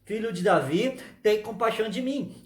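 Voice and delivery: normal talking voice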